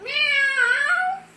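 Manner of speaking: high, loud meow; cat meow. Acted. Cat singing to the snoring dog, high meow.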